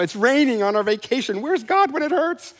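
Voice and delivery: high, squealing complaint, climbing at the end; mock squeal of complaint. Pastor mocking himself griping to his wife, high whiny squeal. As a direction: whine like a spoiled kid whose vacation got rained on. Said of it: "my squeal point is embarrassingly low".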